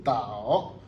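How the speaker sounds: "dao" drawn out low, then rising; "dao" slow on a falling-rising tune; full third tone. Shown as a demonstration. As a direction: dip deep on "dao" and swing up. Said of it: "le troisième reste tel".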